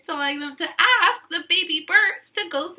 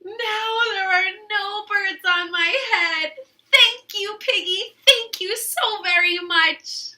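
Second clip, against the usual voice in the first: higher, teary grateful thanks; relieved Elephant; 5 semitones higher, 5 dB louder than usual. Acted. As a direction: Elephant gushing thanks to Piggy. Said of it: "I think he's relieved".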